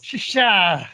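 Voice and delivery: loud, high shouted attack call; playful high holler; mock battle cry. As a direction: yell it like throwing a special move. Mock battle cry in marble fight.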